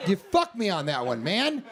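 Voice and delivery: ordinary delivery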